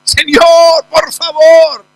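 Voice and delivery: loud high begging cry; mother insisting loudly, pleading for help; insistent pleading. Acted. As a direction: keep crying out, won't give up. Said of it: "la mujer insistía".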